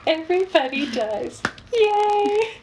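high, squealing laugh through the words; giggling mock cheer. Real, not acted. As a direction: crack up while saying it. Laughing at her own sad stories.

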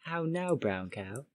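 put-on British "How now, brown cow"; British accent. Shown as a demonstration. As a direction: recite it like a British elocution drill. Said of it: "British person to say well-known sentences".

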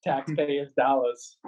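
taxpayers' dollars with dropped Boston r's, slow; Boston accent. Shown as a demonstration. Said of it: "say it with a Boston accent".

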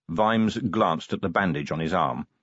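ordinary delivery

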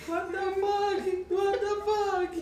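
high, slow, laughing what-the-fuck; laughing disbelief. Retold or imitated. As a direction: squeal it out through laughter. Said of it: "And I'm, like, there, like".

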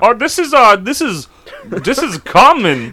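high, loud, slow put-on voice; changed voice. Prankster naming himself to a caller, changed high voice. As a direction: disguise yourself with a big drawn-out high voice. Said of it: "I change it all".

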